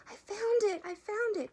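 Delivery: very high, loud cry of discovery; excited quack. A narrator's reading. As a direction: shout the good news, thrilled. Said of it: "quacked the duck".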